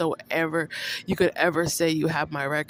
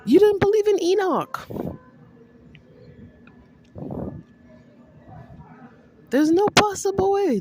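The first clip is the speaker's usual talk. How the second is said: heated outburst; 14 semitones higher, 4 dB louder than usual. Real, not acted. Heated rant at Justin.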